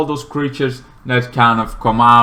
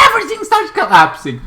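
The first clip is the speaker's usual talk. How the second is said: much higher, excited repeat of the line; dramatic repeat; 5 dB louder than usual. Real, not acted. Retelling the movie's ending to viewers, with a sudden high excited lift. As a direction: pitch it up high to sell the collapse.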